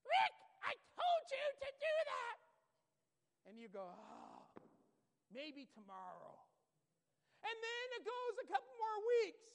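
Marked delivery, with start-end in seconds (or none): 0.0-2.4 high, loud scolding voice; nagging voice. Retold or imitated. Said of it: "And you hear that voice". 3.9-6.4 lazy brush-off. Retold or imitated. Procrastinator brushing it off.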